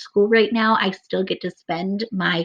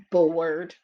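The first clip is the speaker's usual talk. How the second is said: bored, sinking; 3 semitones lower than usual. Shown as a demonstration. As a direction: let "bored" sink down and fade. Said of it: "he is bored".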